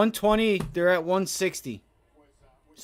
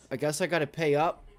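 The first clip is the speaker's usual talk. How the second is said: ordinary talk, nothing marked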